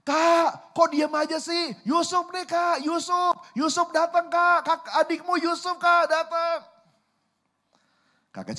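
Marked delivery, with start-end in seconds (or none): 0.1-6.6 high, loud yell demanding big brothers' attention; insistent calling shout. Acted. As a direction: shout again and again, pitched way up. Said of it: "Yusuf teriak lagi".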